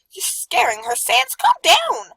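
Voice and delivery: high, loud scolding yell; loud frantic shout. Acted. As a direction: yell at him to calm down. Papyrus shouts at Sans.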